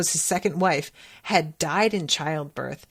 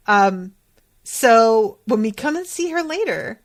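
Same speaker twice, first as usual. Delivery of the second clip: ordinary talk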